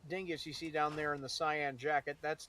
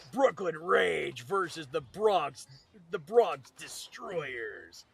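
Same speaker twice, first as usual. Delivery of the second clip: slow, drawn-out sports announcer call; hype announcer; 5 dB louder, much slower than usual. Pretend. Joking with friends, calling a fake matchup like a stadium announcer.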